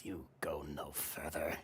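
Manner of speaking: slow, hushed pirate threat; threatening crew member. Acted. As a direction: warn them off in a slow half-whisper. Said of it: "points their sword at you and says".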